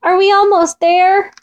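high, plaintive storybook call; mild calling out. A narrator's reading. Mole asks Fox how far, mild shout. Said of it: "shouted Mole".

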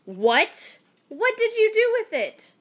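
high, loud shocked question; can't believe what she hears; shocked wife. A narrator's reading. Wife learning the silver is gone, high loud shock. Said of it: "wife reacts to lost money".

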